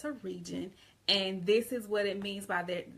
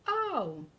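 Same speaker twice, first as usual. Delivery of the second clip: higher-pitched dawning "oh"; sudden realization; 5 semitones higher, 3 dB louder than usual. Acted. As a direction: act out the penny dropping. Acted learner suddenly understanding.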